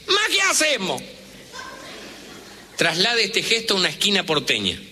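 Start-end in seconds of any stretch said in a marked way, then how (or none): 0.1-1.0 Italian shrug, pitched way up; exasperated Italian "ma"; italiano enojado. Retold or imitated. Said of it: "Piensen en este gesto". (italiano exasperado, voz muy aguda)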